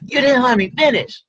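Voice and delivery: high, fast indignant cut-in; indignant protest. Acted. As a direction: rush in, offended at being cut off. Girl cut off in argument.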